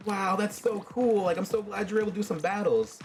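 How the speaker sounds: acted amazed enthusiasm; impressed. Boy impressed by friend's game video.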